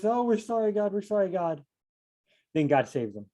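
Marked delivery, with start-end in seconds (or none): 0.0-1.6 high, hammed-up sorry-wailing; mock wail. The people crying out to God after enemies attack, a mild mock wail. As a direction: plead to God like a mock lament. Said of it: "The people raise their voice".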